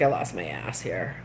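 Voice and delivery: low, mumbled mock misery; a deep, mournful echo of a man who lost it all; imitating glum man. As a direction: play the broke gambler, deflated and low. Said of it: "he was really upset".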